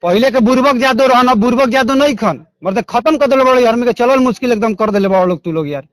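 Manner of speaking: ordinary delivery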